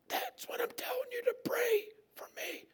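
raspy, effortful plea; sick man's rasp. Acted. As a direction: insist through a voiceless throat. Mute man insists on prayer.

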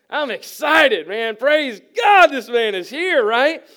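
loud, high, elated outburst; hammed-up excitement. Congregation thrilled that a billionaire has walked in, acted loud excitement. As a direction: shout it like a thrilled churchgoer.

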